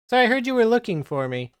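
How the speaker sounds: spring it on them like a surprise; sly NPC voice. Acted. GM voices Publimon's entrance.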